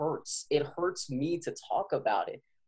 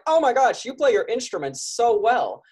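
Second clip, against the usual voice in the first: much higher and louder, gushing praise; gushing admirer; 10 semitones higher, 8 dB louder than usual. Retold or imitated. Fans praising a musician's great technique, gushing high and loud. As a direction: rave about their playing like a starstruck fan.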